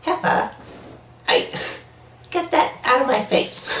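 high-pitched cartoon character voice; Rocko impression. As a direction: put on a high, halting cartoon voice. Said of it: "my Rocko's Modern Life impression".

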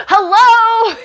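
high, loud, impatient hello through laughter; mock-demanding customer calling for service; pissed-off Karen. A pissed-off customer calling for staff, high and loud. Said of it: "I'm one of those pissed off people".

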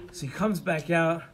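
usual speaking voice

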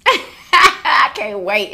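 slow, laughing with delight. Real, not acted. To viewers about the trip, laughing with delight. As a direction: laugh through the words.

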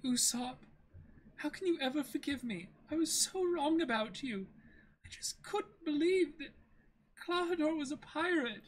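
put-on high, apologetic young woman's voice; Kaya, remorseful girl.

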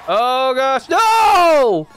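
ordinary delivery

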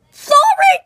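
high, loud squealed sorry; shouted apology. Real, not acted. Spitting out candy.

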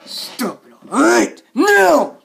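very slow, broken-up angry squawk; upset, strained order. Acted. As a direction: force out each word separately. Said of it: "I'm getting really upset".

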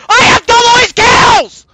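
high, loud protest; indignant shout. Real, not acted. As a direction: scream it in outraged self-defence. Indignant at teammates in game.

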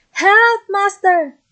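magic harp crying out to wake its master; harp crying for help. Acted. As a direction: shriek it out to the giant. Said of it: "the harp cried".

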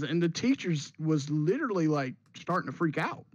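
ordinary delivery

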